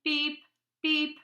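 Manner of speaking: high, loud robot beeps. Acted. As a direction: beep like a little robot. Robots beeping at night.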